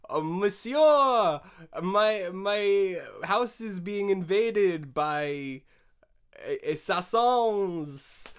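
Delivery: hammed-up frantic phone call; de Gaulle calling the French police, mock panic; mock panicked caller.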